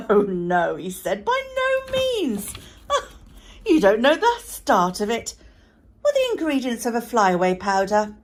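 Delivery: father laughing loudly, amused. A narrator's reading. Father laughs off his daughter's eager question.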